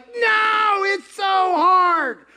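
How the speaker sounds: high, loud, slow whining protest; mock whine. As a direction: whine like a sulky child.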